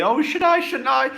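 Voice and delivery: mock the guy who can't decide, high-pitched; mock dithering guy. Coach mocking a hesitant man, high whiny voice.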